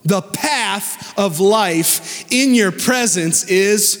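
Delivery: fervent, lifted sermon voice over music; preaching. Acted. Pastor preaching to the congregation, full fervor.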